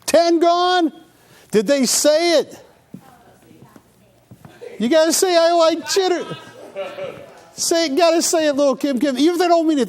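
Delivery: high, excited sales pitch. Real, not acted.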